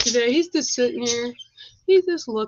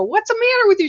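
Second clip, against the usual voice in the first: alarmed retold question; 12 semitones higher, 6 dB louder, faster than usual. Mother answering her panicked daughter.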